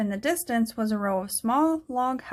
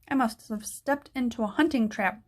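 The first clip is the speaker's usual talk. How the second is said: ordinary delivery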